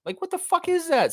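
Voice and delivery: a disbelieving high-pitched what-the-fuck; outraged falsetto question; exasperated squeak. Real, not acted. Ranting at Raptors admin.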